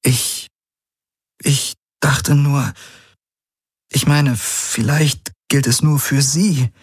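halting, pained uncertainty; faltering, upset. Acted. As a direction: grope for words, clinging to a hope. She falters after bad news.

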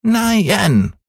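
hohe, quengelnde Kinderstimme; kleiner Junge schreit. Acted. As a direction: fordernd wie ein ungeduldiges Kind. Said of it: "schrie Häwelmann". (hoch und fordernd gerufen)

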